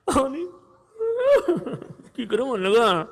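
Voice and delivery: hammed-up sobbing mourner; mock wailing. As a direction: wail in mock grief, breaking into sobs. Imitates mourners arriving wailing.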